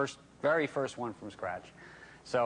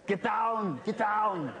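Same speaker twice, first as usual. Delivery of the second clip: Arnold Schwarzenegger impression; 10 semitones higher, much slower than usual. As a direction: bark the order like an action hero. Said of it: "we say".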